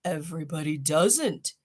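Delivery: lightly voiced sulky rhinoceros; a grumpy beast's retort; angry voice. Rhinoceros correcting father, mild grumpy anger. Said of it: "said the angry voice".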